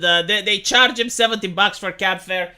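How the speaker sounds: ordinary delivery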